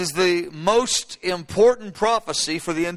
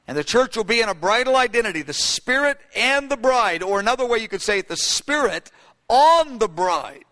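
normal talking voice

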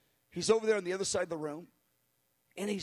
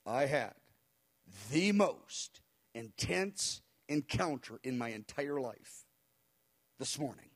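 low, slow, halting solemn delivery; low and weighty, drawn out; very serious, dramatic; 5 semitones lower, slower than usual. Retold or imitated. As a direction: imitate someone gravely overwhelmed, pausing between phrases. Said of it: "very serious and dramatic".

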